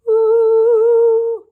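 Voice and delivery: loud, high, sustained "oooh"; high sung ooh. Real, not acted. Showing viewers a dazzling stone, a loud high ooh. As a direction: sing out a long, high ooh.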